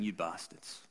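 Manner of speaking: muttered curse, very soft; a low, very soft curse; under his breath. Retold or imitated. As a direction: say it under your breath in disgust. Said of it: "I just kept saying them under my breath".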